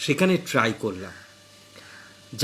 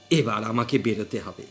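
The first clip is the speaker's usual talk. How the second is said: ordinary delivery, nothing marked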